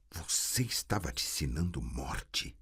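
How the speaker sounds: ordinary delivery, nothing marked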